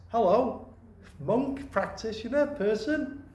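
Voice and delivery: bright greeting. Retold or imitated. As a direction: greet each one warmly as if meeting them. Voicing a cheerful greeting offered.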